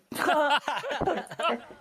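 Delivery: high cackling burst of laughter. Real, not acted. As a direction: crack up at your own gag. Laughs at own joke.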